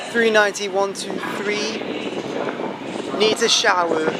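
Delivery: mock the Geordie Big Brother narrator in a lightly done Geordie accent; terrible Geordie accent. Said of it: "That's a terrible Geordie accent".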